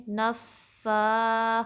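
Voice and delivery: نَفَّا slow, leaning hard into the ff; pressure on doubled f. Shown as a demonstration. Teacher demonstrating pressure, stressed double f in نَفَّا. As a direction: press the f of نَفَّا, then long loud aa. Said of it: "یہاں pressure ڈالیں گے".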